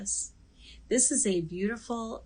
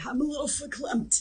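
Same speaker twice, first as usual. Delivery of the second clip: put-on Coffee Talk New York accent, pitched up; imitated New York accent; 6 semitones higher than usual. Host quoting the SNL sketch in a New York accent. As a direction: do the SNL Linda Richman New York lilt. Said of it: "same character that would say".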